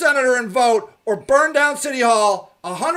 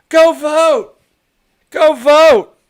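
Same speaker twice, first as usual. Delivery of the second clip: urgent loud plea; 5 semitones higher, 6 dB louder, much slower than usual. Real, not acted. Urging the audience to vote, loud plea. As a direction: go vote, louder and higher.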